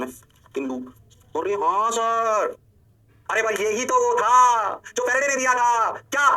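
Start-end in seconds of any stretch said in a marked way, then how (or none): 1.4-2.5 drawn-out, higher "yes sir"; slow singsong realization, acted; mock student agreement. Acts out class's drawn-out agreement. 3.3-6.2 high, loud, stretched-out exclamation; slow, shouted emphasis; emphatic realization. Acted. Acts out excited moment of realization.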